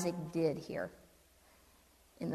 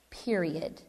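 ordinary delivery

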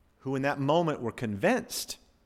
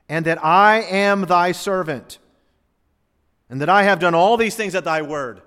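fervent prayer; 3 semitones higher, 9 dB louder than usual. Acted. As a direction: call on God with full preaching force. Prays Elijah's prayer aloud.

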